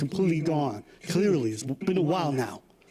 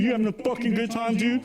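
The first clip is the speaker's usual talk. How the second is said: belligerent frat bro; 7 semitones higher than usual. Pretend. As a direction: pick a fight like a drunk bro. Acting out drunk frat guy.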